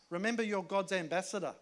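normal talking voice

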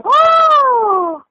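long, high-pitched gasping "oh"; drawn-out theatrical cry of shock; mock shocked cry. Viewer reacting to the series' scene, hammed-up shock. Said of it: "en shock, y que".

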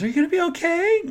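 high, quick questioning voice; concerned seatmate. Retold or imitated. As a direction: imitate a woman checking on you. Neighbor's concerned question, retold.

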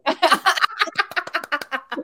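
real high-pitched burst of laughter; long, high cackling laugh. Real, not acted. Cohost tickled by the spreadsheet joke, laughing hard.

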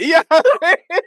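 high, loud laughter through the words; laughing. Real, not acted. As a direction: laugh it out, delighted. Laughing at his own story.

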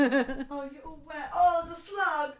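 high cooing to the dog; pet voice. Real, not acted. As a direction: fuss over the wet pet. Fussing over the wet pet.

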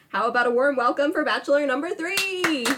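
booming pumped announcer. Acted. Game show host intro.

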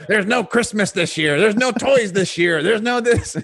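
high, loud cartoon villain decree; Burgermeister Meisterburger. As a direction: bellow it like a grumpy cartoon tyrant. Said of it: "he's like the Burgermeister Meisterburger".

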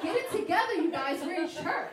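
playful chiding of churchgoers; mock scolding. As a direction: tease the crowd into action. Scolding the quiet crowd.

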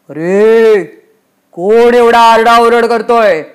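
loud, drawn-out roar; angry lord's bellow; booming Yamraj. A narrator's reading. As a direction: thunder at whoever is making the noise. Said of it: "तेवढ्यात यमराजांचा आवाज".

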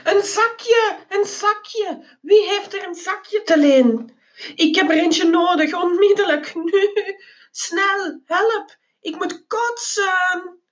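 high-pitched frantic cry for help; desperate, high wail; panicked begging. A narrator's reading. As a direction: plead urgently, about to be sick. Desperate cry for sick bag.

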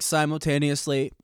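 ordinary delivery

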